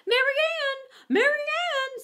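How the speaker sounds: high, loud, drawn-out call from another room; fussy rabbit shouting for his maid. A narrator's reading. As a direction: call her name up the stairs, high and long. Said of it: "said the voice".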